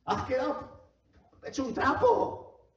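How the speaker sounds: high, wailing mock lament, stretched out at the end; mock tearful lament. Job's friends wailing over him, high mock lament. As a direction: cry it out like shocked, grieving friends. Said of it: "se tiraron llorando diciendo".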